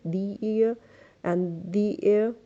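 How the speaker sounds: THE said "thee" before ear and air; slow, each THE as "thee"; vowel-sound article. Shown as a demonstration. Teacher lists vowel words, THE as "thee". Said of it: "इनके साथ भी आप "the" use".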